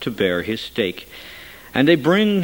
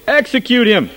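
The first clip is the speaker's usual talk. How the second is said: higher, louder frenzied shout; crying out more; 11 semitones higher, 7 dB louder than usual. A narrator's reading. Crowd shouting even harder for execution, high and loud. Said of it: "cried out the more exceedingly".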